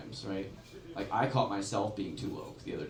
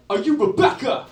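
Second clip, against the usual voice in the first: high, loud questioning call; stranger's call; 13 semitones higher, 12 dB louder than usual. Retold or imitated. Stranger asks comic if Rebecca, loud high call. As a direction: shout the question across the lot.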